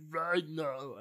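slow, drawn-out bad character voice; terrible Ragnar impression. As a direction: do a hammed-up, badly faked villain voice. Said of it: "terrible Ragnar impression".

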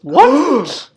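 loud, high exclamation of disbelief; crowd's shock. Retold or imitated. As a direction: gasp it as a stunned crowd. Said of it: "the whole crowd just goes".

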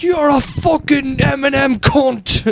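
hammed-up Irish brawler trash talk; mock Irish McGregor. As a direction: ham up a furious McGregor insult. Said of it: "and he was like".

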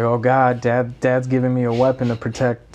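ordinary talk

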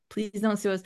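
ordinary talk

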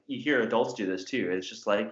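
usual speaking voice

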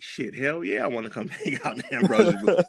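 high, fast and laughing with glee; giddy as a kid meeting Mickey Mouse; excited yes. Retold or imitated. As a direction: blurt it out, laughing with delight. Eager yes to the booth invite.

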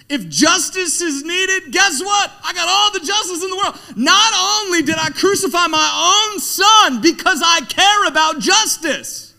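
high, loud preaching roar, God's justice; booming shout. Acted. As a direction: thunder it to the back row. Preacher voicing God's fierce promise.

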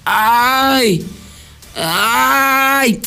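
drawn-out put-on groaning; mock complaining moan. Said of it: "se seguía quejando más".

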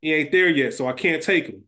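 ordinary talk

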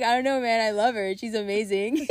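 hammed-up helpless lover defending the girlfriend; imitated smitten boyfriend. Boyfriend's helpless defence, retold laughing.

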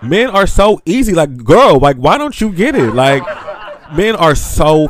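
high, loud, exasperated rant; laughing disbelief, pushing it up; loud incredulous hype; excited. Real, not acted.

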